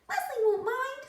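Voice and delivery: high, squeaky talking pigtail. A narrator's reading. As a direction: play the cheeky pigtail, way up high.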